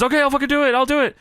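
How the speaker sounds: high, hammed-up eager yes; mock eager agreement. Angelina accepting the title match, high and hammed-up. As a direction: blurt it out like taking the dare. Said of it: "And she says".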